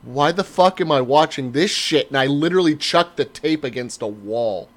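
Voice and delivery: ordinary talk, nothing marked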